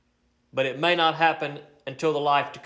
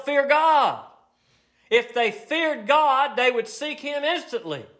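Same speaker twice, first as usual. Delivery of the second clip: preaching at full force; 9 semitones higher than usual. Acted. Preacher warning people who don't fear the Lord, fiery preaching. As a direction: thunder it at the congregation.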